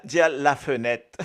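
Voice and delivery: "la fenêtre" with a laugh, full French form; "la fenêtre" en riant, forme française. Shown as a demonstration. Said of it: "Nous milat". (dire "la fenêtre" en riant)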